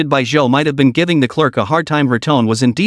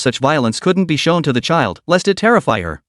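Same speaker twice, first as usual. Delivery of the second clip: plain reading voice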